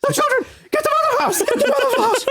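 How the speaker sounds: very high squealed scream, breaking into laughter; hammed-up hysterical imitation scream; mock screaming. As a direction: shriek the blooper line, half laughing. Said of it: "he's, like, screaming".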